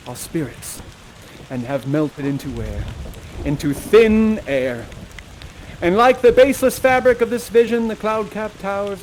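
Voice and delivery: grand farewell monologue; verse recital. Acted. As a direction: deliver the famous lines with weight. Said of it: "final words here".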